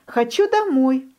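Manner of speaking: high, whiny хочу домой with домой pushed up; whining duckling. A narrator's reading. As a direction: wail "хочу домой" high like a homesick chick. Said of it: "ноет утёнок".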